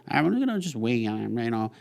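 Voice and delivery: high-pitched parody of a market-news follower; silly high voice; mocking put-on voice. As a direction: mimic someone parroting the TV news. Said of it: "Why do you have to do that voice?".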